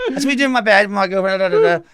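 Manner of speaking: loud, high mock outburst; hammed-up agitated protest; retold upset. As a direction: imitate a wronged man ranting, played for laughs. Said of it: "the guy was really upset".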